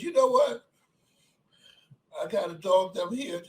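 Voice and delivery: ordinary talk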